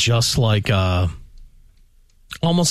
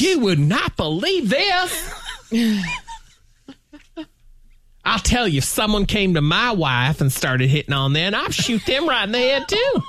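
mock Oklahoma man; 9 semitones higher than usual. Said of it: "they'd be like".

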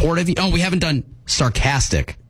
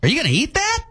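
high-pitched, rising query; questioning; 11 semitones higher than usual. Pretend. Host attempts sarcasm but comes out questioning. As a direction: ask it as a genuine puzzled question. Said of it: "That was questioning".

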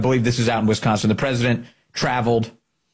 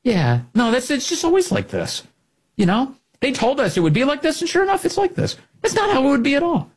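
high, whiny mock of smug anchors; mocking media voice; 12 semitones higher than usual. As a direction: parody the media's excuses, pitched way up. Mocking media talking points.

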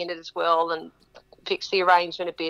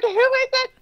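much higher, laughing question; curious crowd. Retold or imitated. Crowd asking who the wife is, high laughing squeal. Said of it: "They're like".

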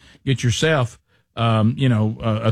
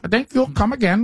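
put-on higher, halting try at an Indian accent; attempted Indian accent; 8 semitones higher than usual. Host repeating the cohost's line in a weak Indian accent. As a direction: copy the Indian accent, not quite there. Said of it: "I don't do a great Indian accent".

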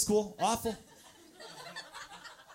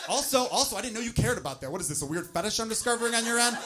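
mock defensive retort; 6 semitones higher, 4 dB louder than usual. He retorts mockingly to partner.

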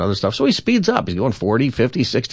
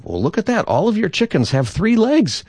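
high-pitched marvelling; amazed driver; 11 semitones higher than usual. Acted. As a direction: joke character wondering aloud, pitch climbing. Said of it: "he goes".

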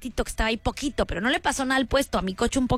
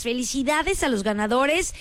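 ordinary talk, nothing marked

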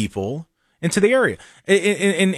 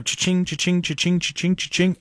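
cha-ching cash register sound; 3 semitones higher than usual. Retold or imitated. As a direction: chant the register noise.

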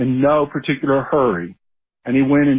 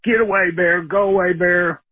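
higher-pitched shout to scare off a bear; calling out to shoo the bear, raised pitch; retold yell at a bear; 5 semitones higher than usual. Homeowner shooing a bear from the garbage, mock yell. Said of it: "and yelled".

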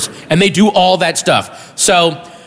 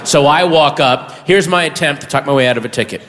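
ordinary talk, nothing marked

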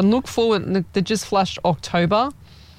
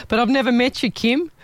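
amused surprise; 7 semitones higher than usual. Real, not acted. Viewer says best ever met.

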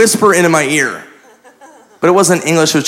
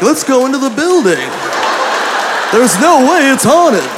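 cheery cartoon hero; 7 semitones higher than usual. Mock Scooby-Doo cheerful line.

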